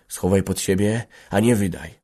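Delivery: usual speaking voice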